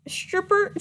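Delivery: ordinary talk, nothing marked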